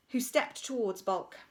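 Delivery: ordinary delivery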